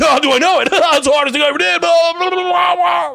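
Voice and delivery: high, loud fast rambling; mock coked-up chatter. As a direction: gush about parenting like a wired host. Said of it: "And he's like".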